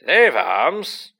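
loud, high exclamation; loud mock astonishment. A narrator's reading. Hunt leader spots living guests, loud mock astonishment. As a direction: call it out as if astonished. Hearty ghost greets the living, feigned shock.

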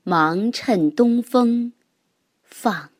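slow, measured poem reading; poem recitation. Acted. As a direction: recite it slowly, line by line. Reads a classical poem thrice.

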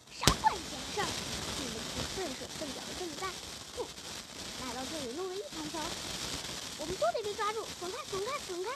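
high, loud, fast scolding; angry rat. A narrator's reading. Rat drives off clumsy intruders, furious. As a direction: scold the bunglers in quick bursts.

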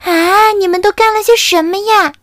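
high, loud shocked wail; dismayed cry. Acted. Camelido cries out in dismay, high and loud. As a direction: gasp at the mischief.

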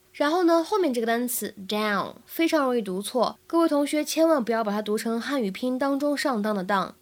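usual speaking voice

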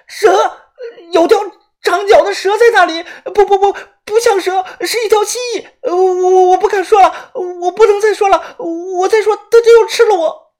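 high, loud sobbing panic; terrified wailing. Acted. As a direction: cry out in terror. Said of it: "风言风语地大声喊".

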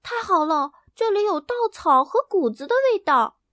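sniffing out something wonderful; excited. Acted. Hen Hena smelling straw in the barn, excited. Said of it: "小鸡叫道".

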